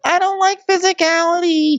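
very high put-on squeak; squeaky prissy voice. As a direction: squeak it like a prim housewife. Ashley voiced in story.

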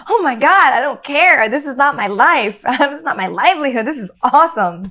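high, loud, elated outburst; gleeful inner voice. Retold or imitated. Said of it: "inside, I'm so happy".

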